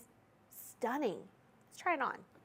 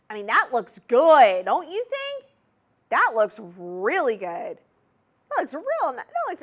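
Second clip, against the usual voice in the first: louder, with a Southern accent slipping through; 2 semitones higher, 10 dB louder than usual. Real, not acted. Host admiring the ring on her finger, Southern accent slipping in. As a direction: let the Southern accent sneak out as you gush. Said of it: "my southern accent was coming out there".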